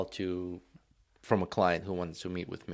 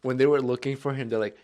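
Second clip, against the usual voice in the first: ordinary delivery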